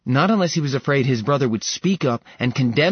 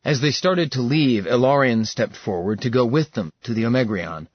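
plain reading voice

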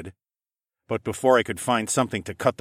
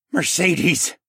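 higher, breathed-out oath; breathed exclamation; 8 semitones higher, 4 dB louder than usual. Acted. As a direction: breathe it out in shock. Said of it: "Captain Nemus breathed".

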